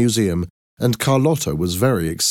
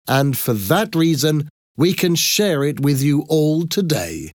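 higher-pitched address to a crowd; exhibition presenter; 7 semitones higher than usual. Acted. Thomas explains the exhibit to the crowd, formal presenting. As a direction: present it proudly to an audience, pitched up.

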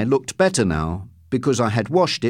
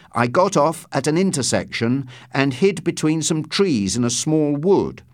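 ordinary delivery, nothing marked

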